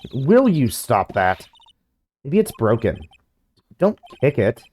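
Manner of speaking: ordinary talk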